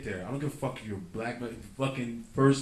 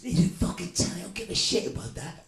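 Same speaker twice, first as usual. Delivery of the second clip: loud, higher geezer yell; hammed-up Cockney outburst; mock British shout; 7 semitones higher, 6 dB louder than usual. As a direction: bark it like an angry Londoner. Voicing British movie character.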